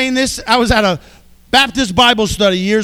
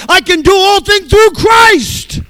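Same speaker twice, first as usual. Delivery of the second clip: slow, loud and higher, proclaiming every word; proclaimed shout; 8 semitones higher, 5 dB louder than usual. Shown as a demonstration. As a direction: shout it out as a triumphant declaration.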